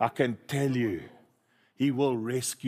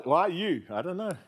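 usual speaking voice

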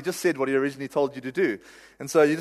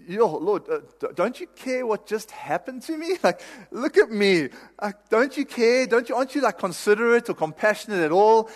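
much higher, pleading whine; whiny Jonah; 6 semitones higher than usual. Retold or imitated. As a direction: play Jonah complaining like a sulky kid. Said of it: "I can imagine Jonah going".

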